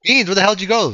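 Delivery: loud call into the headset. Real, not acted. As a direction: shout out asking where he went. Calling out for missing friend.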